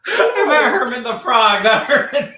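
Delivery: high, loud protest through giggles; helpless laughter. Real, not acted. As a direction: try to correct yourself while cracking up. Cracking up over slip.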